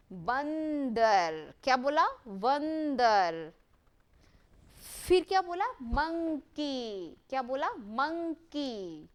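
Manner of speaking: बंदर and monkey each stretched very slowly; slow modelling. Shown as a demonstration. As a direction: model every word slowly so children copy. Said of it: "आप मुझे देखिए, हम कैसे इसको बोल रहे हैं". (धीरे-धीरे बोलकर सिखाना)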